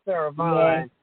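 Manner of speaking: usual speaking voice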